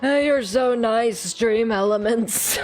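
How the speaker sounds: put-on slow, touched old teacher; lightly voiced grateful old man. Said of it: "he's still getting the old man voice".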